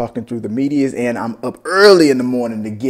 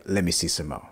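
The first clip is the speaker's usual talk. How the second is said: whispering; 6 semitones lower, 12 dB quieter than usual. Retold or imitated. Voicing viewer wanting more.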